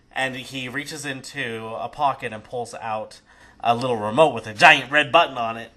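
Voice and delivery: normal talking voice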